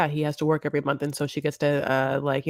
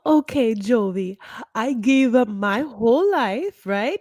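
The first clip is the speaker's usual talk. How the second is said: high, slow, whiny complaining wife; mock aggrieved wife; 7 semitones higher, slower than usual. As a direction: drag out every grievance in a high whine. S1 voicing Yara's tirade.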